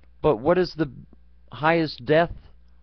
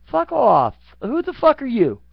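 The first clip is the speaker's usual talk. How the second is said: much higher and louder, a furious yell; angry yell; 11 semitones higher, 6 dB louder than usual. Retold or imitated. Yelling at a self-important politician, furious loud shout. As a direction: scream it at him, furious.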